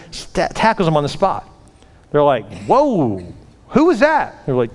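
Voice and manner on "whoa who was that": astonished question, pitched way up; amazed. Retold or imitated. Coaches see a huge tackle, amazed reaction. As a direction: react in disbelief, voice leaping high.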